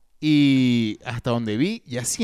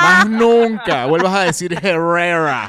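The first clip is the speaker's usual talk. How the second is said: loud, slow mock warning; scolding character; 6 dB louder, slower than usual. Host parodying the pronunciation scold, mock scolding. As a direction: forbid it with comic indignation.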